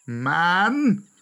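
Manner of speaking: loud, high rising incredulous question; scornful roar. A narrator's reading. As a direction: roar it out in disdain. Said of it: "Bagheera roared out scornfully".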